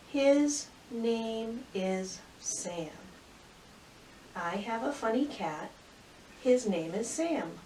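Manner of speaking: put-on slow, clear read-aloud for young kids; reading to children. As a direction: read the story slowly so little ones follow. Reads story aloud to children.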